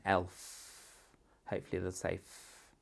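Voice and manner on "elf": "Elf" with a long final f; stress on last sound. Shown as a demonstration. Teacher asks for the last sound, long f in "Elf". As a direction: hold the f at the end of "Elf". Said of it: "What's the last sound?".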